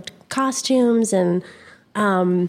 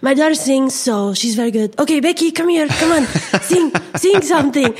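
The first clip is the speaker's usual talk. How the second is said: pushy dad, higher and louder as he goes; boastful stage dad; 5 semitones higher, 4 dB louder than usual. Retold or imitated. Dad showing off his daughter at a piano bar, boastful and pushy. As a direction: build from proud announcement to urging her on. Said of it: "And he'll be like".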